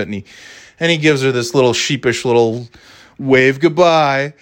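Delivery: normal talking voice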